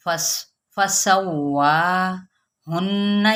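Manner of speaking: फसव्वाहुन्ना very slowly, each syllable clear; slow recitation. Shown as a demonstration. Teacher repeats the word slowly for students. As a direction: draw it out syllable by syllable. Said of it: "repeats word for students".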